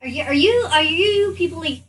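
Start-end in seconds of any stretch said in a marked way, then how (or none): none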